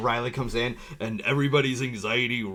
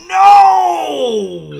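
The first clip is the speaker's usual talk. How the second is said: anguished shout of no; 12 dB louder than usual. Retold or imitated. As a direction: cry out in despair. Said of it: "I'm like".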